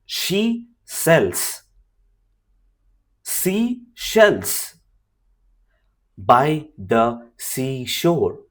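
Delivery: slow, careful tongue twister, word by word; deliberate slow drill; slow demo reading. Shown as a demonstration. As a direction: spell it out slowly with gaps. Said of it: "say out these tongue twisters out loud".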